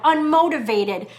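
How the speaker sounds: normal talking voice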